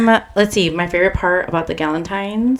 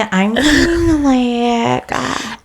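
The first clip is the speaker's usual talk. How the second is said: slow creaky vocal fry; 6 semitones higher, much slower than usual. Acted. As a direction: stretch it out in fry. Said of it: "The vocal fry".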